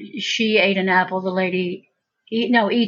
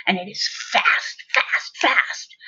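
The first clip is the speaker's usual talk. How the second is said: rushed whisper; slower than usual. Shown as a demonstration. Describing grown-ups' fast magic.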